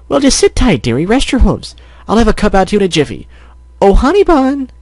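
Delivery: high-pitched, fussing bakery lady; welcoming shopkeeper. Acted. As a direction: welcome a customer, high and eager. Voices Mrs. Cake in story.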